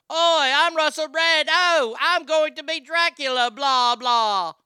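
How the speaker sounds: loud, high-pitched lazy Dracula impression; boisterous British comic barely attempting Dracula's accent; Russell Brand. Host shows how a comedian would play Dracula, brash Russell Brand voice. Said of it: "That's how he would do it".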